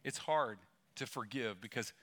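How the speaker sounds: usual speaking voice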